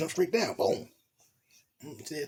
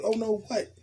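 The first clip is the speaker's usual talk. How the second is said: voicing the woman higher, scolding and worried; a lightly voiced, higher fretful woman; girl's worried voice; 5 semitones higher than usual. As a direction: play the anxious girlfriend fussing over him.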